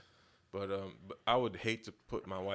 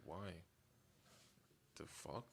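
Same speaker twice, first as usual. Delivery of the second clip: 1 muttered disbelief; 4 semitones lower, 18 dB quieter, slower than usual. Retold or imitated. His reaction to homegirl request.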